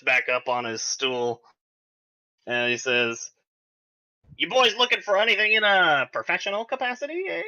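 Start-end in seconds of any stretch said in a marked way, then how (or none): 4.4-7.4 higher, quick salesman patter; merchant voice. Acted. Max sizing up the boys, high quick salesman voice. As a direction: sell it like a sly shopkeeper.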